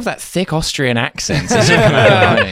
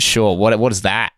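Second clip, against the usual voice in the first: normal talking voice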